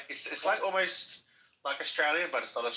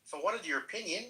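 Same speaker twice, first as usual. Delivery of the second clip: put-on fast, higher quick Kiwi-accent line; mock Kiwi accent; 6 semitones higher, 4 dB quieter, much faster than usual. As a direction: rattle it off too Kiwi. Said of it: "like a Kiwi".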